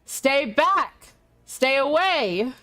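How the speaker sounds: mock booming yell. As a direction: play a thunderous warning without full volume. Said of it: "very loud, booming voice, almost like the thap, clap of thunder".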